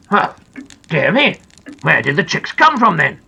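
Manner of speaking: plain reading voice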